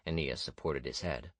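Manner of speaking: plain reading voice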